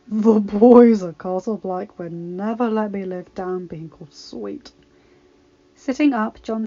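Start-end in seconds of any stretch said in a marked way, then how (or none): 0.1-4.7 slow, low northern brogue. Acted. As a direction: drawl it in a deep northern brogue. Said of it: "in his deep northern brogue".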